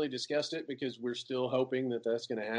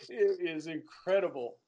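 ordinary delivery

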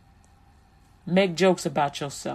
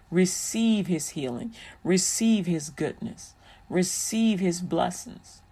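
tender preaching blessing. Acted. Slow soft preaching blessing.

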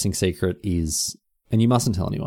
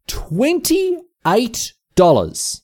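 very slow, high, stretched out; repeating the price, stunned and slow; drawn-out dismay; 3 dB louder, much slower than usual. Real, not acted. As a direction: let the price sink in slowly.

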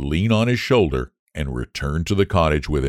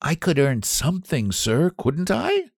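pleading; 9 semitones higher than usual. Acted. Boy pleading with the squire for work. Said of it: "His tone was so pleading".

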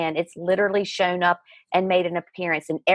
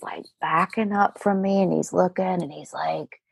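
ordinary talk

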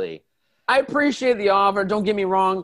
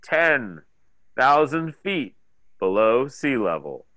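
announcer voice; 6 semitones lower than usual. Acted. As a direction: announce the depth like a submarine intercom. Submarine announcement.

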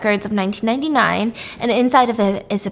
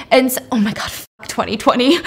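normal talking voice

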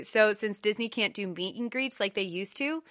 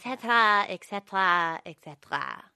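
put-on Edna Mode accent; 3 dB louder than usual. Cohost repeating et cetera, a light Edna Mode accent. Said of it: "that was a good Edna Mode accent".

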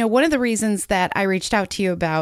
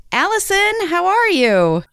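bright, much higher and louder hello; excited greeting; 11 semitones higher, 8 dB louder, slower than usual. Real, not acted. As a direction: greet her with big energy. Host greets guest warmly.

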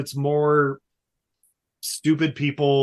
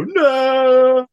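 much higher, louder mock cry of no; mock old man no; 10 semitones higher, 7 dB louder than usual. Old man looking back at the bagpipes, loud high no. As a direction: wail a dismayed no. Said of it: "look back and go".

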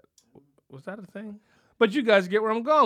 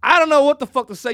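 loud, higher ranting disclaimer; comic rant; 5 semitones higher, 9 dB louder than usual. Acted. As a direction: rant it at the audience.

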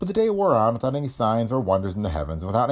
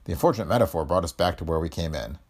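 ordinary delivery

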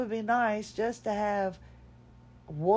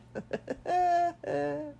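high-pitched chuckle; real laugh at oneself, pitched well up; 10 semitones higher than usual. Real, not acted. Laughs at own timing to record.

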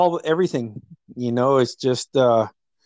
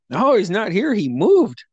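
higher-pitched imitation of insisting; retold insistence; 7 semitones higher than usual. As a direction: insist in a raised, re-enacted voice. Retelling deadpan prank's insistent denial.

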